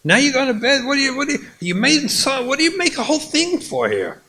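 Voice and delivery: high, indignant complaint; exasperated husband. Acted. Husband scolding his wife for making a fuss, high and indignant. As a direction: throw it back at her, incredulous.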